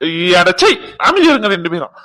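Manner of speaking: angry shout. Acted. Telling the bickering couple off.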